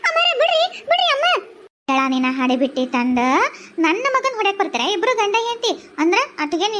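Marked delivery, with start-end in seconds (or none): 0.1-1.2 pleading shout. Acted. Pleading to stop the fight. 1.9-3.2 low menacing scold. Acted. Old landlady scolds, voice dropped.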